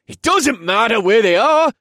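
high, loud angry yell; shouting. Acted. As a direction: yell it back in desperation. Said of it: "another one shouted".

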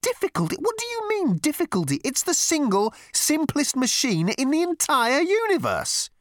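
very high, outraged disbelief; incredulous exclamation. A narrator's reading. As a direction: explode in squeaky-high incredulity, slowing at the end. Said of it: "exclaimed Ford".

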